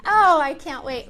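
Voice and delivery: loud eager exclamation; thrilled, loud and lifted; excited. Real, not acted. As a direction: burst out with eagerness. Excited about song service.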